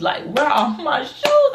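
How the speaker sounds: high, drawn-out mock shout; rising, stretched-out retold cry of alarm; mock panicked yell. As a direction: wail it in exaggerated disbelief. Retold drunk panic over missing shoes.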